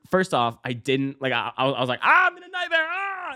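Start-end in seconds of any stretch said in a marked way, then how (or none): 2.0-3.3 very high, hammed-up scream of terror; playacting panic in a nightmare; mock terror.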